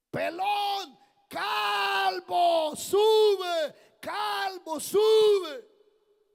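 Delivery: high shouted chant, vowels stretched; repeated taunting. Acted. Boys repeatedly taunting Eliseo to go up, jeering shout. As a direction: heckle loudly, one long word at a time. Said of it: "se lo dijeron varias veces".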